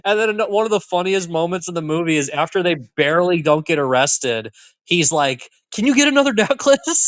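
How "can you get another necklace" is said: high-pitched mock pleading, half laughing; cheeky request. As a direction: ask it sheepishly high, imitating him. Acts man's cheeky request, laughing.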